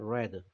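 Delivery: "read" said softly as "red"; short e in "read", kept quiet and low; past-tense read. Shown as a demonstration. Teacher repeats the past pronunciation, "read" as a soft "red". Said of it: "요게 과거 발음이에요".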